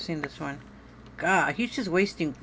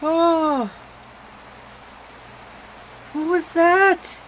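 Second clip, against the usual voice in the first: drawn-out frightened cry; 8 semitones higher, much slower than usual. Real, not acted. Player terrified by a monster, slow frightened cry. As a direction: stretch it out in real fright.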